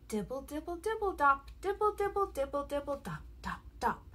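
soft rain patter; raindrop patter. A narrator's reading. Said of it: "He can sound like the rain".

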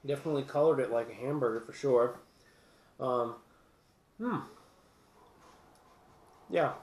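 speaking between bites; talking while chewing. Real, not acted. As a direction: mouth partly full of food. Seen 0: bites piece at -1, then holds pieces near mouth, likely chewing.

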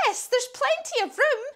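high, delighted storybook witch; acted witch's high-pitched yes; witch agreeing. A narrator's reading. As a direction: welcome them in with a high lift. Witch answers after a thought.

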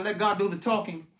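ordinary delivery

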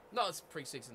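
usual speaking voice

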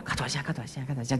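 very soft repeated urging; hushed imitation of egging someone on. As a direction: prompt them gently under your breath. Retells coaxing a crying follower again.

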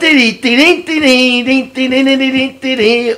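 imitated accordion. Contestant auditions with an accordion, singing it by mouth. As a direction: sing an accordion tune on dings. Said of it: "I do it with an accordion".

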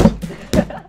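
breathless wheezy laugh; wheezing laugh. Real, not acted. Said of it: "My wheezy laugh".

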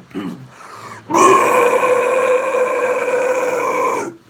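harsh high unvoiced roar; grizzly scream. Shown as a demonstration. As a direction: rip out a long grizzly bear scream. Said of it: "my favorite go-to one is my Grizzly".

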